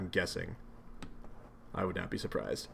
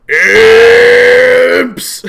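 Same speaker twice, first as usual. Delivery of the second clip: furious shout; 8 semitones higher, 19 dB louder than usual. Acted. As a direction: bellow "Imps!" much higher and louder. John yelling at imps.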